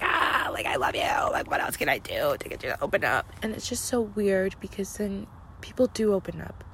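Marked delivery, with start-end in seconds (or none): 0.0-3.2 exasperated whisper-shout. Retold or imitated. Imagined pleading while shaking them.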